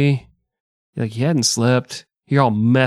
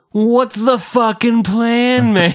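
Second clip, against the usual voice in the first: high, slow, incredulous cry; exasperated soldier; 11 semitones higher, 4 dB louder, slower than usual. Retold or imitated. A soldier demanding Paulus's plan, incredulous. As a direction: stretch it out in disbelief.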